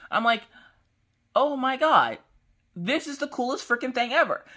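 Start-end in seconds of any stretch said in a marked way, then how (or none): 1.3-4.4 much higher, excited gush; real delighted excitement; thrilled squeal. Real, not acted. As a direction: gush like it is the best news ever. Thrilled at the delivery.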